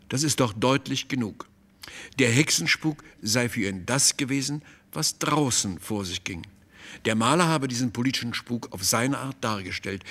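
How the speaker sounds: plain reading voice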